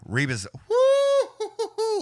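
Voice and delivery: shrill falsetto woo hoo hoo; woo-hoo cheer. Real, not acted. Streamer whooping at a flurry of punches, falsetto cheer. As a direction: whoop way up high like a fan.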